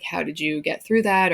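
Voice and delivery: normal talking voice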